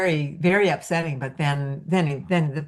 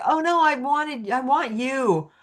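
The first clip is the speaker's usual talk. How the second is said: lightly voiced eager owner, higher; a hint of an owner pleading, higher; man promising to save his dog, higher; 7 semitones higher than usual. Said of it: "this guy was like".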